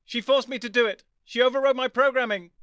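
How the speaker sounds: high, loud robot pleading; a robot crying for help; panicked robot. Acted. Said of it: "These cries came from K nine".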